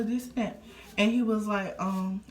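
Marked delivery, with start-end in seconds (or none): none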